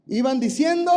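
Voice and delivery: high, loud call to the crowd; preacher rousing the crowd, loud; loud proclaiming. Shown as a demonstration. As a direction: say it like you mean it. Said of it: "así no decía nadie".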